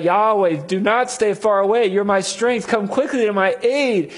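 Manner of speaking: high, loud plea for help; crying out. Retold or imitated. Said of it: "He cries out".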